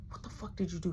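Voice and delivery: breathy hushing whisper so mama stays asleep; urgent whisper. Retold or imitated. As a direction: shush him in a tight whisper. Whispering at night.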